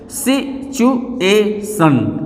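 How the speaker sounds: "Situation" slowly, as सि, चु, ए, शन; syllables separated. Shown as a demonstration. Teacher counting syllables, "situation" slowly split in four. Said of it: "पहले इसको अलग करिए".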